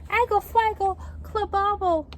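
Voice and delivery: put-on a frustrated baby trying to be understood; insistent baby babble. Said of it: "said Trixie again".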